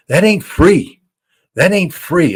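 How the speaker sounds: emphatic repetition. Real, not acted. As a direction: drive the point home hard. Hammering home the economic cost.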